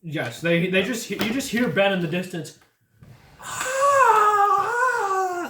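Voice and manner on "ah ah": high, loud wail of pain; distant pained scream. Acted. Ben screaming in the distance, high pained cry. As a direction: cry out from far off. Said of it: "That's how he sounds".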